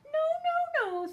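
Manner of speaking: tiny high panicked voices; high squeaky refusal. Acted. Wolves refusing the pig.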